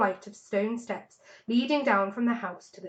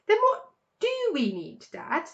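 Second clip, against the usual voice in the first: put-on child fox; 9 semitones higher than usual. A narrator's reading. Smallest fox asking father.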